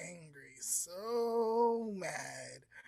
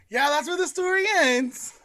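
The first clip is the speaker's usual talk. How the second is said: loud insistence; 9 semitones higher, 13 dB louder than usual. Real, not acted. Insisting after friend's shock.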